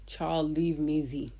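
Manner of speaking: ordinary delivery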